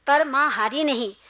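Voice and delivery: ordinary delivery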